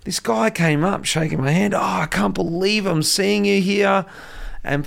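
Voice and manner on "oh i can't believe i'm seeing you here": high, slow, gushing fan voice; starstruck fan. Retold or imitated. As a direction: gush like an excited fan. Fan meeting him, retold.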